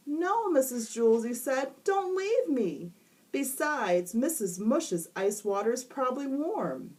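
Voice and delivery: put-on high, overdramatic boy voice; whiny stuck boy. A narrator's reading. Stuck boy complaining to teacher, high whine. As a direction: wail it like a doomed kid. Jason pleading.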